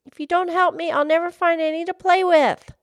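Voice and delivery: high, loud plea; high-pitched desperate little lass; little girl crying out. Acted. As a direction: plead in a high child's voice, no sobs. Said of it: "she cried".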